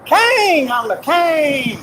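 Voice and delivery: high, drawn-out mock proclamation; boastful king. Hammed-up boast of being king.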